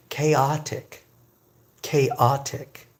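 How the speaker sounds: "Chaotic" in careful separated syllables, kay-OT-ik; pronunciation demo. Shown as a demonstration. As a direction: sound out "Chaotic" for learners with a hard K. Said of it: "Pronounce it like that".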